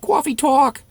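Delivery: high New York "cawfee tawk"; New York accent. Acted. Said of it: "It's a New York accent".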